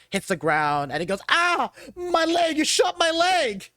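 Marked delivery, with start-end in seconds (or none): 1.3-3.7 high-pitched, drawn-out cry of pain; hammed-up agonized yelp; wounded thug. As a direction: wail like a man just shot. GM voices man shot in leg.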